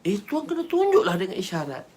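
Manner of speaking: high-pitched objection; exclaimed protest. Acted. Companion tells the Prophet to signal clearly, high protest.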